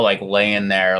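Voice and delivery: usual speaking voice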